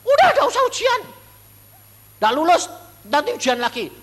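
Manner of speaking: high, loud urgent shout of a friend; shouted order. Retold or imitated. Friend shouting at the preacher to skip his exam, high loud yell. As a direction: yell at him to drop the exam and go home. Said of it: "teman-teman saya ke-, kedokteran bilang gini".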